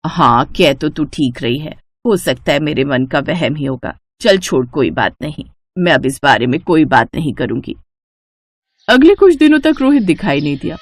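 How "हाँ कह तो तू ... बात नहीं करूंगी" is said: mother-in-law dropping the subject; resigned mother-in-law. Acted. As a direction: let it go with a low sigh in the voice. Shobha backs down.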